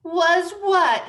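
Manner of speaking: sharp high demand. Acted.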